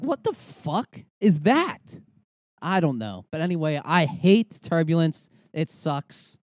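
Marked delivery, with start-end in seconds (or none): 0.0-2.0 slow, high-pitched disbelief; baffled outburst. Retold or imitated. Passenger reacting to a garbled cabin announcement, exasperated and baffled. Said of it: "And I'm like".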